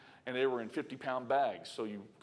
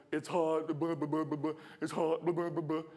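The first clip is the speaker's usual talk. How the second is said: high singsong griping; mock complaining; 5 semitones higher than usual. Preacher scolding coworker by mimicking his high complaint. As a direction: parody the whiner, blah blah. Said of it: "It does no good to be like".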